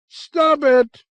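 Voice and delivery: very high, loud scream; screeching. Acted. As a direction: shriek it to cut everyone off. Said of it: "With a blood-curdling screech".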